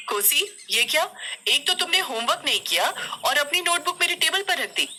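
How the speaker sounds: high-pitched, reproachful schoolteacher; indignant teacher's telling-off; scolding teacher. A narrator's reading. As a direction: demand an explanation from the pupil.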